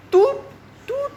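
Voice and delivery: very high, held "tut" bleeps; imitated censor beep. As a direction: bleep the word out by voice. Repeats the self-censored insult. (tiruan bunyi bip sensor yang nyaring)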